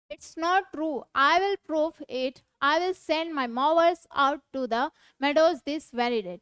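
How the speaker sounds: deliberate dramatic reading of a play; slow classroom read-aloud. Acted. Reads Natalia's lines aloud.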